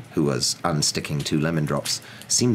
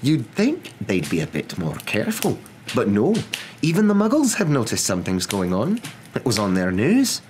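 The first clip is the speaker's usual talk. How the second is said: higher, indignant complaint; impatient professor; 6 semitones higher, 4 dB louder than usual. Acted. Professor complains that Muggles have noticed, impatient. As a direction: push up high on each grievance. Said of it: "she said impatiently".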